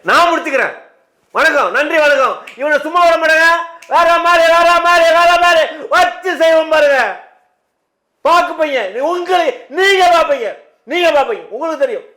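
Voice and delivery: high, loud storming-off rant; angry threats. Acted. Guest ends the interview himself and threatens the host, angry.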